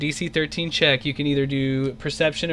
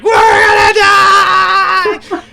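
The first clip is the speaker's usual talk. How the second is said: very loud, high, drawn-out panicked scream; terrified scream; 13 dB louder, much slower than usual. Acted. Pingo gripping the hero's shoulders, screaming in terror. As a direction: scream it with all your lungs. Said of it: "he's like".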